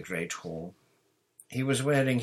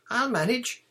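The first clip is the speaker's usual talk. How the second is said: high, loud assurance; airy schoolgirl confidence; breezy Hermione; 7 dB louder than usual. A narrator's reading. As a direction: brush the worry off in a high voice. Hermione breezily dismisses Ron's worry.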